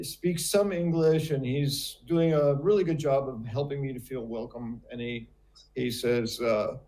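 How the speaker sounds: normal talking voice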